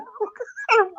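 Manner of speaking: high, soft burst of laughter; amused laugh. Real, not acted. Friend remembering the Top story, laughing. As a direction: laugh helplessly at the memory.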